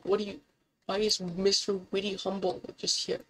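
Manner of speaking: ordinary talk, nothing marked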